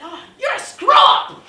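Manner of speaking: loud, high and slow jeering cry; mocking shout. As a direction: drag it out, mocking her at full volume. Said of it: "She said".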